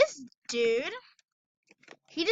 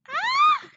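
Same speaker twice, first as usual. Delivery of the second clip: high startled scream; 10 dB louder than usual. Real, not acted. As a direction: shriek in sudden panic. Caught by helicopter in game.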